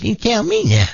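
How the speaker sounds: high, put-on old lady voice, defiant; an eighty-eight-year-old demanding her place; feisty old granny. Granny telling the Hells Angels she wants in, in a high put-on old voice. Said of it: "gets off, says".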